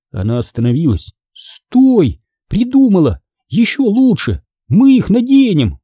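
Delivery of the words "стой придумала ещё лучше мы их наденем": high-pitched burst of short exclamations; excited brainwave. A narrator's reading. Hanna stopping short with a better plan, excited. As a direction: blurt out the better idea eagerly.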